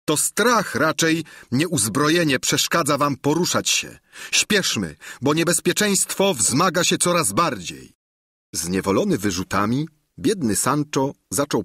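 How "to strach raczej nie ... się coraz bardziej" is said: higher, pressing voice; teasing courtier. A narrator's reading. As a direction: hurry him with mock urgency. (dworzanin ponagla)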